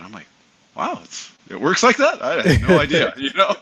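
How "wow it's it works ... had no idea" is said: very high, incredulous retold reaction; astonished. Young pilot learning the airline route from friend, astonished. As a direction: pitch leaping up in acted disbelief.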